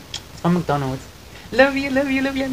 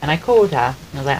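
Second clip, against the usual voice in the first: ordinary talk, nothing marked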